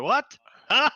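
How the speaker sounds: high, loud confused yelp; startled shout. Acted. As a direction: shout out in sudden alarm. DM voices confused guard.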